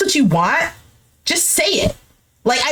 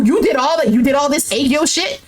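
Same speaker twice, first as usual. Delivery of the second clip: usual speaking voice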